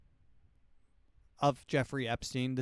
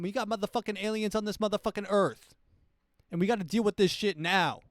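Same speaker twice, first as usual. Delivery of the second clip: much higher, louder hammed-up tirade; ranting president; 9 semitones higher, 7 dB louder than usual. President in twenty thirty-eight ranting about aliens, high loud yell. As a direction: rant like a president losing it over aliens. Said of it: "This will be the president in twenty thirty-eight".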